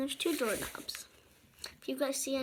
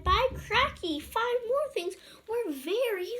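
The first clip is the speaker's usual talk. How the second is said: much higher and louder, slowed; high, loud astonished story voice; amazed exclamation; 10 semitones higher, 5 dB louder than usual. Acted. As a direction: cry it out in wonder.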